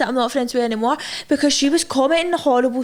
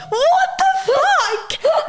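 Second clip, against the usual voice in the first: high, drawn-out laugh-through of what the fuck; real giggling shock, stretched out slowly; laughing disbelief; much slower than usual. Real, not acted. Laughing at creepy comment.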